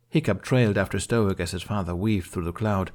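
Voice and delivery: plain reading voice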